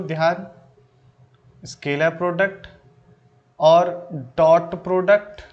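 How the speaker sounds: measured dictation pace with long pauses between phrases. Acted. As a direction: spell it out phrase by phrase so students can write. Dictates notes to students slowly.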